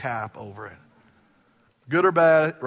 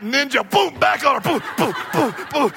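shouted fight sound effects; 9 semitones higher, 6 dB louder than usual. Retold or imitated.

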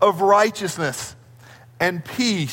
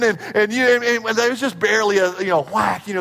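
ordinary talk, nothing marked

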